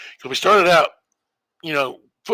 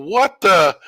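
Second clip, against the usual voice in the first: startled shout; 5 semitones higher, 6 dB louder than usual. Retold or imitated. Opponents startled by play.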